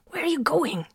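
high-pitched, tense demand; hissed. A narrator's reading. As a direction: hiss it urgently over the comm. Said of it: "Solomon hissed over the comm".

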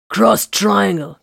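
slow, loud tactical call. A narrator's reading. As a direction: call the order across the room. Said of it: "Corvin called".

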